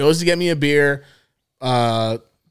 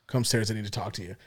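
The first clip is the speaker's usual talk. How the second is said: hushed, low, quick imitation of mother; low stern mom; 5 semitones lower, 12 dB quieter, faster than usual. Mom summoning son away from his friend, low and stern. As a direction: repeat the summons low and fast.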